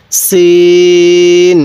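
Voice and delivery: সিন held very long, thin and firm s; thin hard sin. Shown as a demonstration. Teacher models Arabic sin, thin and hard, very slow. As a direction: draw out সিন with a thin sharp s. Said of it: "সিনের আওয়াজটা একটু চিকন করে শক্ত করে".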